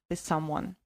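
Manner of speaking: ordinary delivery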